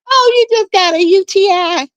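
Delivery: retold joyful oh you just got a U T I; imitating happy relief. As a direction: play Noelle's gleeful relief. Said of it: "Noelle was so happy when she said".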